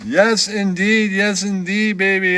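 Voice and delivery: slow drawled yes indeed; smooth catchphrase. Acted. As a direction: savor the catchphrase like a lounge host. Catchphrase bit.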